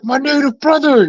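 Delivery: high, happy shout of brotherhood; high excited buddy voice; excited greeting. Retold or imitated. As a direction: greet him like long-lost family. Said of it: "He was like".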